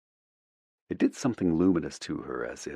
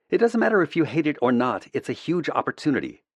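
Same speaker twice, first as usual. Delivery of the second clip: firm higher woman's voice; brisk PR woman, firm; 9 semitones higher than usual. A narrator's reading. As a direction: insist like a no-nonsense PR rep. PR lady Ava voiced higher.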